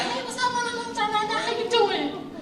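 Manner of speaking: very high put-on girl's voice with regional accent. Girl answering a man on the street, high put-on regional accent. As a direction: squeal it high as an imitated girl. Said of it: "have this regional accent going on".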